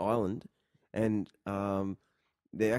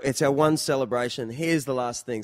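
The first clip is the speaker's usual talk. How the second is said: ordinary talk, nothing marked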